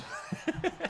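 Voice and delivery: breathy high-pitched giggle; helpless real giggle. Real, not acted. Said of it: "Sorry, I can't help it".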